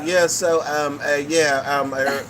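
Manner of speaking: mock British accent, hesitant with ums; fake British accent. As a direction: imitate a put-on British accent, stalling between words. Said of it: "a British accent this whole goddamn time".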